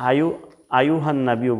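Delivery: hurried "আইয়ুহান নাবিয়্যু" as ayuhan-nabiyo; ya left unpronounced. Shown as a demonstration. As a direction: slide through "আইয়ুহান নাবিয়্যু" with single short y. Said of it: "বলা যাবে না". (ইয়া বাদ দিয়ে ভুল উচ্চারণ)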